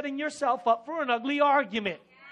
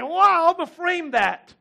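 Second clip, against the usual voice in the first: excited; 7 semitones higher, 9 dB louder than usual. Real, not acted. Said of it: "Isn't that powerful?".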